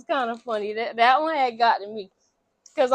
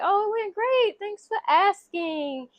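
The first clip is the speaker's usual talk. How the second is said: mock polite reply; 8 semitones higher than usual. Retold polite friend small talk.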